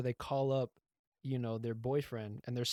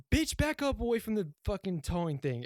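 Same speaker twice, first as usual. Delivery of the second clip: high-pitched mock yell; Bernice; 8 semitones higher, 4 dB louder than usual. Tow crew woman orders someone back, mock yelling. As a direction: imitate a woman yelling a warning. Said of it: "she just yells at people".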